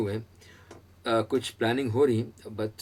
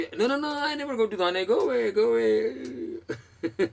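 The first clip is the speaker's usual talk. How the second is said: high, loud, laughing no no no; mock shocked refusal; 12 semitones higher, 4 dB louder, much slower than usual. Teacher mimics a confused stranger at the bus stop, high laughing protest. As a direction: playfully act a flustered stranger refusing. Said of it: "तो ये नहीं बोलेंगे कि".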